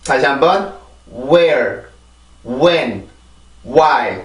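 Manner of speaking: where, when, why with no H, low and falling; silent-H drill. Shown as a demonstration. Teacher drills students on silent-H words. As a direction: drill each word plainly, low pitch. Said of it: "정확한 발음을 읽어보자면".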